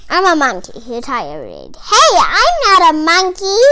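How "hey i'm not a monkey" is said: loud, rising "hey" of outrage; hammed-up huffy denial; indignant protest. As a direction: play the insulted reader. Reader protesting the book's line.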